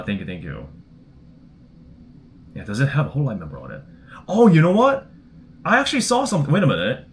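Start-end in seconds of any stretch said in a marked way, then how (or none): 4.3-7.1 sudden realization, much higher; aha discovery. Real, not acted. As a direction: it just clicked, voice jumps up. Suddenly remembers vending machine.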